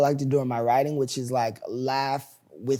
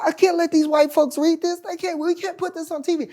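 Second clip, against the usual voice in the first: high-pitched imitated refusal; mock protest; 12 semitones higher than usual. Comedian balks at showing the script, imitated in high protest.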